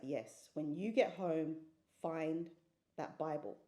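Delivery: low, slow confirmation with pauses; measured inner command. Retold or imitated. God confirming to her to find the Bible, low and deliberate. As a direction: repeat the instruction deliberately, spacing the last words. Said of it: "He's like".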